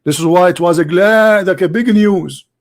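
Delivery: ordinary talk, nothing marked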